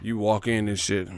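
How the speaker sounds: usual speaking voice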